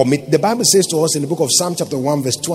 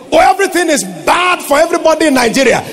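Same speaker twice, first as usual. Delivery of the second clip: quick, higher mock of the complainers; mocking the doubters; 11 semitones higher, 4 dB louder, faster than usual. Pastor mimicking people who complain about Nigeria, mock whine. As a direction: toss off their gloomy talk. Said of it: "So don't say what they say".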